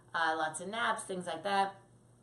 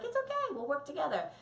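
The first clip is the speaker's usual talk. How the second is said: high soothing reassurance; lightly high reassuring owner; 8 semitones higher than usual. Shown as a demonstration. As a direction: comfort the startled puppy. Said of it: "you're like".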